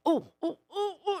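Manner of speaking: high, soft mock "oh, oh" of alarm; climbing little oohs, played lightly; imitated guest gasps. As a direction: mimic distracted guests spotting something. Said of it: "We've had guests in going".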